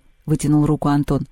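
ordinary delivery

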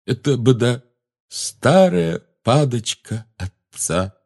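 slow, stuffed-nose voice; bloody-nosed. A narrator's reading. Neville explains about his father's wand, stuffed-up and slow. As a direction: talk slowly through a bleeding nose. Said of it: "Кровь сочилась у него из носа".

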